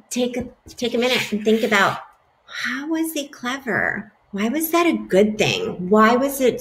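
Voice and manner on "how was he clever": ordinary delivery, nothing marked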